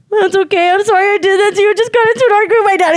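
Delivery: high, fast, whimpering apology; retold tearful apology. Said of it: "He started crying again".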